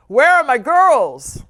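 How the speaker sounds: loud hearty grandpa call. Acted. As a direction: call warmly across the house. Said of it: "grandpa call".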